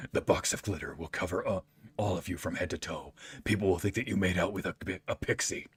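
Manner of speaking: low, measured villain monologue; Jigsaw. Acted. Villain explains a glitter trap to his captive, low menacing voice. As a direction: lay out the trap with deadpan menace. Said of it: "Jigsaw becoming an anime girl".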